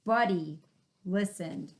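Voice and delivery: ordinary delivery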